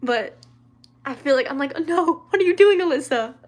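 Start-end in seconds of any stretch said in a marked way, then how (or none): 1.8-3.3 higher, louder mock-panicked reaction; mock self-scolding. Streamer voicing her embarrassment at copying accents, mock self-scolding. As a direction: play up the dismay at yourself. Acted scolding of herself, mortified.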